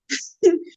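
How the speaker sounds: short high giggle. Real, not acted. Giggling at her own slip to the interviewer.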